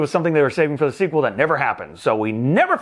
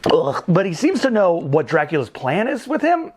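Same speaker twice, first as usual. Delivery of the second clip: ordinary delivery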